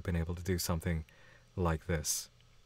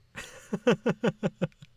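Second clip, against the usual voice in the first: high, breathless burst of laughter; high shrieking laugh; 12 semitones higher, 4 dB louder than usual. Real, not acted. Streamer shrieks with laughter imagining the cursed gift. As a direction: crack up into a shriek.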